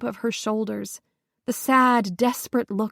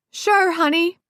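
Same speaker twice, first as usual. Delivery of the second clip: raised and higher, a slight quiver; called back; 8 semitones higher, 4 dB louder than usual. A narrator's reading. Upset mother calling back to her son, covering a slight quiver. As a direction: call back brightly, hiding the strain. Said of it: "Jess called back ... only the slightest quiver".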